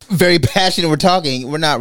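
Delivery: high, loud burst of passionate talk; seemingly yelling. Retold or imitated. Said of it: "what may seem like yelling and screaming".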